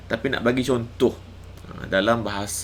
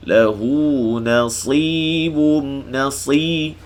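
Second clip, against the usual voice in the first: Quran recitation; 3 semitones higher, 5 dB louder, much slower than usual. Shown as a demonstration. Teacher reciting a verse for students to follow, Quran chant. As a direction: recite yashfa' stretching the shin. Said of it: "Maksilah qasirah".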